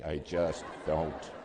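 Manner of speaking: each word slow and loud; slow emphatic. Real, not acted. Preacher insisting to the congregation, slow and loud. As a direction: lean hard on every word. Pastor insists on his point.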